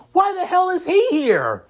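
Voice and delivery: shrill high-pitched, loud cry of disbelief; outraged squawk at an unwelcome rival; indignant outburst. Acted. Issei blurts out at rival.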